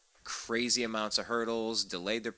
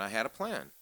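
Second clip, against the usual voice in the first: ordinary talk, nothing marked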